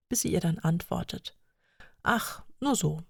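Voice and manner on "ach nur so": dreamy coy. Acted.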